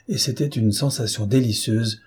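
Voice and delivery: plain reading voice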